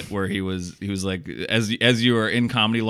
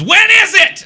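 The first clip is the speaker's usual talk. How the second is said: much higher, louder panicked squeal; panicked waking thought; 11 dB louder than usual. Retold or imitated. Host mimics waking confused, panicked high loud squeal. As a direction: squeal it like you just woke up in a panic. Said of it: "the first thought when I wake up is".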